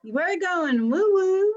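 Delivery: high, loud, drawn-out cheer; playful whoop. Real, not acted. Host gleefully tells guests we're going woo-woo, playful whoop. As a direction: announce it with rising, whooping glee.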